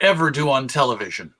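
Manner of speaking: ordinary delivery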